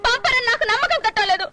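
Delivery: hurt and weeping; tearful refusal. Acted. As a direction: answer through sobs. Wife tearfully refuses husband.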